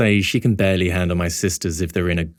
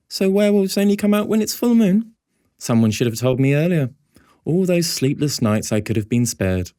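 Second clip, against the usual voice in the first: put-on higher, playful voice of a joking girl; teasing girl; 9 semitones higher than usual. A narrator's reading. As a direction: Zoe joking about the werewolves. Zoe teasing.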